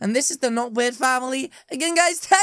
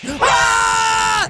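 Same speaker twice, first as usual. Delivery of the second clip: high, loud scream; frightened scream; 8 semitones higher, 5 dB louder than usual. Acted. Player sees mannequin turn, loud frightened scream. Mannequin comes to life, attacks.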